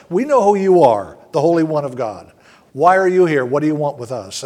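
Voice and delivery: loud, forceful acted outcry; crying out. Evil spirits challenging Jesus, crying out. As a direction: raise your voice in the spirits' challenge, falling off at the end. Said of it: "were crying out".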